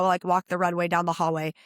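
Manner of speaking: ordinary talk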